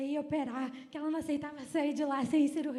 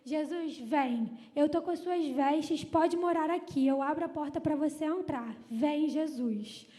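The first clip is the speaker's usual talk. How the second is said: ordinary talk, nothing marked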